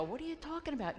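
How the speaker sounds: high-pitched imitation of a baffled protest; mock bafflement. Guy on the blanket to the prankster, mock-bewildered.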